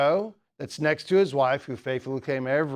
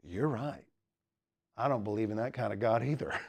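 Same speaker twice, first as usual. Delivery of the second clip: low, soft, measured agreement; calm reply; 5 semitones lower, 7 dB quieter than usual. Retold or imitated. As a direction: answer the rant quietly, with pauses. Pastor's calm reply to ranting atheist.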